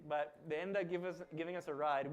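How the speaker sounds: ordinary delivery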